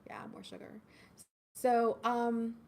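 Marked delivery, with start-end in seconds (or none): 0.1-0.7 soft conspiratorial whisper. Real, not acted. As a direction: whisper it like a guilty secret. Confiding about sugary treats.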